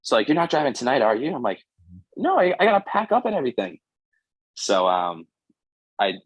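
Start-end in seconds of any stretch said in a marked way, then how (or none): 0.3-1.3 higher, concerned mom; a mother checking on her son; a hint of a worried mother; motherly worry. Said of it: "the motherly instinct". 2.2-3.8 high, breezy reassurance; high, put-on son voice; light reassurance. Reassuring mom on phone.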